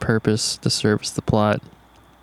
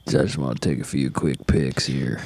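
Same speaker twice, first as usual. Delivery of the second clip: creepy low voice; 11 semitones lower, 6 dB quieter than usual. Retold or imitated. Creepy photographer impression.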